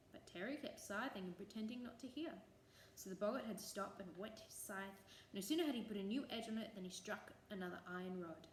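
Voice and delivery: usual speaking voice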